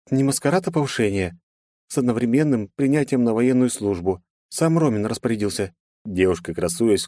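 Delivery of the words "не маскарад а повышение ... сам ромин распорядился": put-on higher, pleased young woman; proud young woman. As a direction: show off the promotion. Narrator voices Marina.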